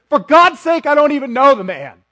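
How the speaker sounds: loud exasperated swearing; shouted frustrated denial; angry oath. Acted. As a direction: fling the denial out in anger. Peter's denial retold in second person.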